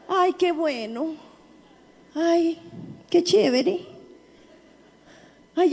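acted wonder of a man just healed; hammed-up, marvelling beggar; healed lame man. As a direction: play the cripple discovering he is well, with little gasps of "ay". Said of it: "que dijo el cojo?".

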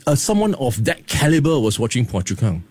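ordinary delivery

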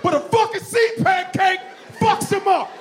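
shouting in disbelief, high and laughing. Real, not acted. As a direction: yell it out through laughter. Excited rant about stingray.

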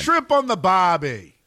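slow, loud, high Australian drawl; Australian accent. Pretend. Cohost riffs in Australian accent. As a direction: go full Aussie cliché. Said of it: "That's an Australian accent".

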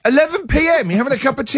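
shocked host asks about tea at eleven at night; surprised. Real, not acted. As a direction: gasp at the late hour.

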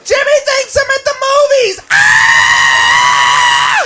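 high mock squeal into a scream; gleeful confession. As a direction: blurt the secret and shriek.

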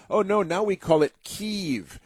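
high, prissy mock of media saying Kyiv. Host mimics media correcting Kiev to Kyiv, mocking. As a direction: sneer at them as you quote. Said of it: "Democrats told us".

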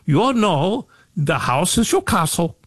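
an Irish lady's lilt, only hinted at; Irish accent. Retold or imitated. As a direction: lightly put on an Irish woman's accent. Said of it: "she said in her accent".

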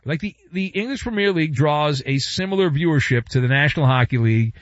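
ordinary delivery

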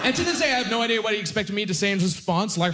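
ordinary delivery

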